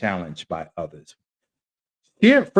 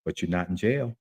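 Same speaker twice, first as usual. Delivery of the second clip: God's voice; 4 semitones lower, 7 dB quieter than usual. Retold or imitated. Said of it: "I heard God's voice say this".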